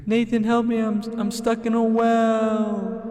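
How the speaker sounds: high, slow cry for help; calling up from a well. Pretend. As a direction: plead from the bottom of a well. Pretend stuck in a well.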